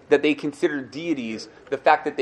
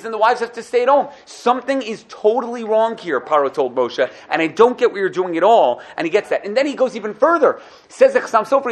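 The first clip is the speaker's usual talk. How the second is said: normal talking voice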